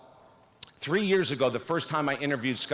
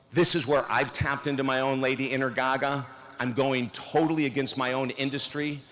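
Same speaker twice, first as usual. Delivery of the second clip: ordinary talk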